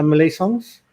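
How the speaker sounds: normal talking voice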